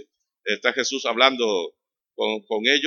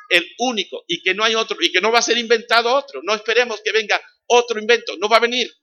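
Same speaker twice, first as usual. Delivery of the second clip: higher and louder, driving each phrase; fiery preaching; 9 semitones higher, 7 dB louder than usual. Real, not acted. Preacher to congregation, fiery full-force preaching. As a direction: preach it hard, no other invention coming.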